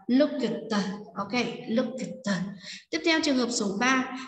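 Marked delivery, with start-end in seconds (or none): 0.1-0.9 "look at the" read as "looked", with a separate released t; đuôi -ed đọc /t/. Shown as a demonstration. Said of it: "mình sẽ đọc là". 1.7-2.3 "look at the" said as "looked", k and t released apart; đuôi -ed đọc /t/. Shown as a demonstration. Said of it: "mình sẽ đọc là".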